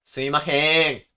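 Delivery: loud, drawn-out goofy call; joking call. Shown as a demonstration. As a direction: call for staff in a silly, stretched way. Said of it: "あ、ふざけてる".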